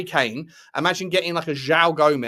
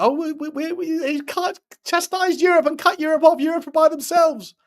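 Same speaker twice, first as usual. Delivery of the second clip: much higher, mocking put-on voice of the president; mock Donald Trump; 12 semitones higher, 4 dB louder than usual. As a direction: lampoon the politician in a high squeaky voice. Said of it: "And he's like".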